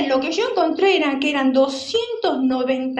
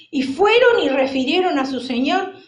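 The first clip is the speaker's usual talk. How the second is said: normal talking voice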